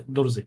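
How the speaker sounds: Druze said as durzi, no stress on the final e; Arabic pronunciation. Shown as a demonstration. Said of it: "no emphasis on the E".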